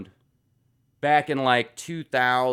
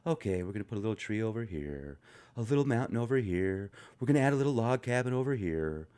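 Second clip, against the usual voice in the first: soft, fast painter voice, softer; Bob Ross impression; 3 semitones lower, 9 dB quieter, faster than usual. Mocking the calm TV painter while reading comments, soft and quick. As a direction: imitate the calm TV painter, hushed. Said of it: "The guy that would sit there and paint".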